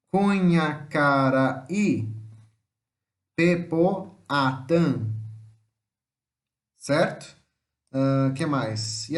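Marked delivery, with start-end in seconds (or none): 0.1-2.1 CUNHACARAÍ very slow, final Í set apart; teacher's drawn-out breakdown of Cunhacaraí; syllable by syllable. Shown as a demonstration. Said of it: "quatro ou mais sílabas". 3.3-5.0 PEPOATÃ very slow, split into clear beats; teacher's drawn-out breakdown of Pepoatã; syllable by syllable. Shown as a demonstration. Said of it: "quatro ou mais sílabas".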